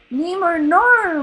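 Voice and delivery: loud, high, slow cry of horror; he thinks his son is caught; anguished cry. A narrator's reading. Marlin seeing the net fall on Nemo, slow anguished cry. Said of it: "Marlin cried".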